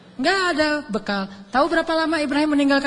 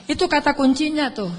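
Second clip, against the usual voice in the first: ordinary talk, nothing marked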